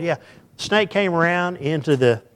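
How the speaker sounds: ordinary delivery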